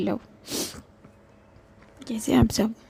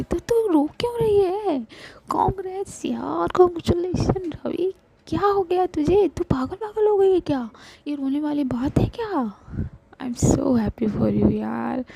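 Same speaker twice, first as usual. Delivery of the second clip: much higher, thrilled congratulations; overjoyed friend; 8 semitones higher, 5 dB louder than usual. Acted. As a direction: bubble over with happiness. Shivi overjoyed. (खुशी से उछलती सहेली, बहुत ऊँची आवाज़)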